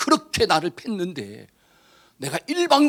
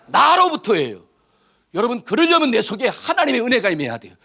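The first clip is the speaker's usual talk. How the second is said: loud, high, fervent pulpit preaching; 7 semitones higher, 5 dB louder than usual. Acted. Preacher urging the congregation toward repentance, loudly. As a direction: drive it home to the congregation.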